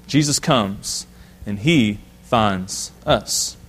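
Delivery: drawn out slowly, low and soft, rising at the end; slow, emphatic repeat. Shown as a demonstration. Preacher to church, slow emphatic repeat. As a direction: let each word land slowly. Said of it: "repeats the line for emphasis".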